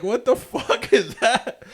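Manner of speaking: high, loud, slow retold horror; appalled disbelief. Customer reacting to the line in his hair, appalled. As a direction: drag out the disbelief, laughter underneath.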